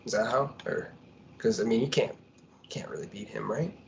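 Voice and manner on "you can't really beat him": whisper. Real, not acted. Aside to the fighter.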